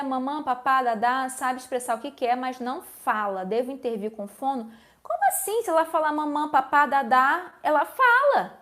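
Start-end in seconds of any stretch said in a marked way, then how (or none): 5.0-8.6 high, incredulous challenge to what was just read; puzzled, emphatic objection. Real, not acted. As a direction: push back in surprise, pitch leaping at both ends. Reage contestando a pergunta lida.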